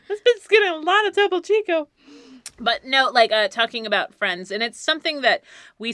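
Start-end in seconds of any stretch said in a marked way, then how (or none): 0.1-1.9 high, laughing mock announcement. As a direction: cackle it out gleefully. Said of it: "I'm like".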